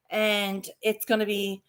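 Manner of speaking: ordinary talk, nothing marked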